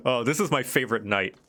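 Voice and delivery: ordinary delivery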